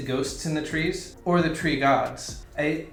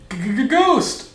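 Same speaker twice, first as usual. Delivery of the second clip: high, loud stuttering ghost wail; 13 semitones higher, 12 dB louder than usual. Pretend. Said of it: "do your ghost voice thing?".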